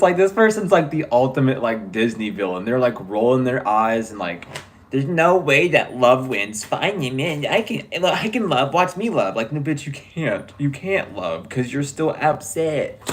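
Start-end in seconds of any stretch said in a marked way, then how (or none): 4.9-9.4 mocking petulant voice. Mimicking the rival's petulance.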